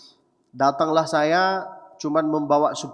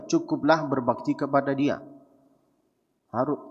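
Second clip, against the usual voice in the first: ordinary talk, nothing marked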